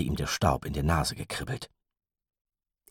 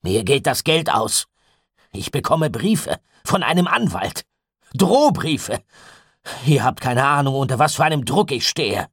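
higher, louder, pressured outburst; rough, agitated; 9 semitones higher, 7 dB louder than usual. A narrator's reading. Gideon pours out his money troubles, agitated. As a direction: vent the strain, rising. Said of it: "Gideons Stimme klang rau".

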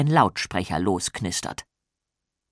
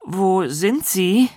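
nervous; 9 semitones higher, 3 dB louder, much slower than usual. Acted. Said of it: "fragt Maja nervös".

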